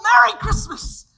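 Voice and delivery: loud, high, slow exultant holiday cry; joyful shout. Acted. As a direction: shout it to the whole house. George joyfully greets everyone.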